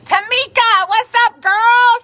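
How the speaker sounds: light up and call her name; excited greeting. Real, not acted.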